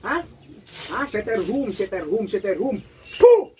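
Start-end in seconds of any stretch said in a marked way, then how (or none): 3.2-3.5 a sharp forceful puff of breath; loud ritual spit; ritual spitting puff. Real, not acted. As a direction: blow out hard like spitting on the spirit. Healer spits ritual breath.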